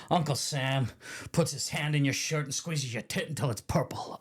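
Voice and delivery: lightly voiced guard quote; prison guard impression. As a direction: put on a hint of the crude guard. Quoting Hadley in put-on voice.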